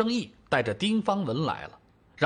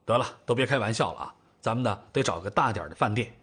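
quick, lower-pitched practical cut-in; hurried; 6 semitones lower, faster than usual. A narrator's reading. Elder brother cutting short the family's joking, hurried. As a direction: step in briskly to end the joking. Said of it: "连忙说道".

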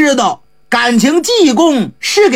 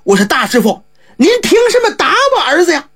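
higher and fast, rising in protest; anxious, angry father; 7 semitones higher, faster than usual. A narrator's reading. Squire protesting as the monk hits his son, higher and fast. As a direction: snap at the monk in alarm. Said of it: "当时就急了".

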